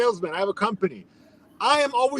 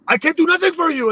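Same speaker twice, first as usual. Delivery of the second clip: high-pitched mock outburst; worked-up, defensive refusal, retold; freaking out; 8 semitones higher, faster than usual. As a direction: imitate a clerk losing it. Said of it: "And she starts freaking out".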